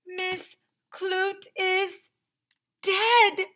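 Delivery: high slow build to a loud shout. Acted. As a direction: cry out the news in shock. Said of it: "she shouted".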